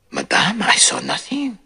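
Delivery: hushed, breathy whisper; calm her in a whisper; Poirot whispering to the frightened widow. Acted.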